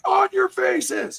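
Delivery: high, slow barked order; high drawn-out police command; cop raid command. Retold or imitated. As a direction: bark it like a raiding cop.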